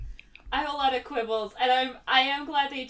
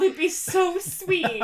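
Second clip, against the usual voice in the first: laughing; 8 semitones higher, slower than usual. Real, not acted. Gushing over the sweet henchman.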